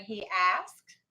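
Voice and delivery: ordinary talk, nothing marked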